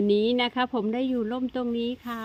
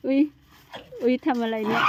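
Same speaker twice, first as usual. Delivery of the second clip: usual speaking voice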